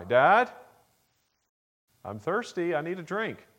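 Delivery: put-on a small boy pleading from bed for a drink; child calling out.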